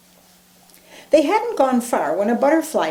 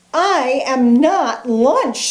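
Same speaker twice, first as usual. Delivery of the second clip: slow, louder indignant sputter; 4 semitones higher, 5 dB louder, slower than usual. Acted. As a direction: protest being called lunch. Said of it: "sputtered Mouse".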